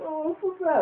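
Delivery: squeaky high crying audience; high mock sobbing voice. People in the theater crying, high mock voice. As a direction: pitch way up, mock weepy.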